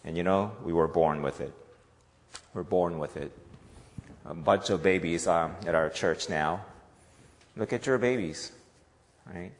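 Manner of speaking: ordinary delivery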